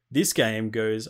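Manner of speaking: ordinary delivery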